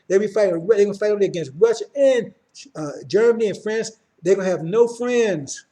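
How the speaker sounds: loud, driving preacher building to a peak; preaching at full force. Acted. Preacher warning brothers and sisters of war, preaching hard. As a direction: hammer the warning home to the congregation.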